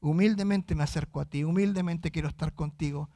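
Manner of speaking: low, hushed voice of submission; humble prayer. Acted. Believer approaching God in prayer, humbly. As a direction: speak softly, bowing before God. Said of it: "delante de Dios humildemente".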